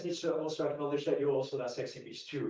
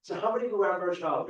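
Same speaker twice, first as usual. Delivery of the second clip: louder repeat; 5 semitones higher, 8 dB louder, faster than usual. Real, not acted. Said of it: "audience barely answers, he repeats".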